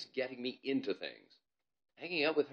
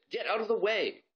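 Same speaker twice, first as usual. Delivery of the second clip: higher, louder command; bossy girl; 11 semitones higher, 6 dB louder than usual. Acted. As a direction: shoo him aside. Ivalo bossing Gazink.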